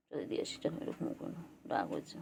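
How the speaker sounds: soft whispered coaxing. Real, not acted. Leading the cat back to its place, whispered. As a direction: coax the pet along in a whisper.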